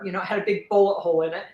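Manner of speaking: ordinary delivery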